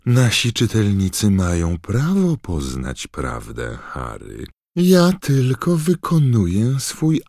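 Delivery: high, sly, prying tabloid reporter; gossipy journalist voice. Acted. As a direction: drip with nosy glee. Rita justifies herself sweetly.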